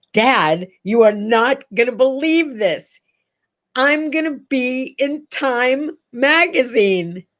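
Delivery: proud, drawn-out reveal; retold excited announcement. Daughter phones dad with big news, excitedly. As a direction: build up to big news, slowing at the end.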